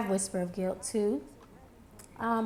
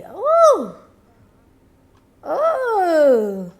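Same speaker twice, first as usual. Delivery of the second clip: delighted ooh; 9 dB louder, much slower than usual. Real, not acted. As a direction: coo at how pretty it looks. Admiring highlighter glow.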